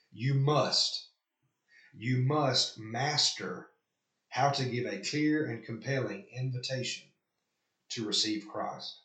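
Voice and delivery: "must" pressed down hard, then "clear" lifted, all spoken very slowly; slow emphatic quote. Shown as a demonstration. Pastor quoting the article to his listeners, slow and emphatic. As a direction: read each word as if underlining it.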